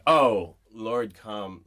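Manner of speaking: a loud Oh, then a plea of Lord, come; mock plea. Shown as a demonstration. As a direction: cry out Oh, then plead.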